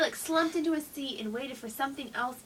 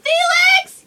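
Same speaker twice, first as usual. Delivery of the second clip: high, loud shout of the name; yell; 17 dB louder than usual. A narrator's reading. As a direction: yell the boy's name across the kitchen. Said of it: "yelled his mom".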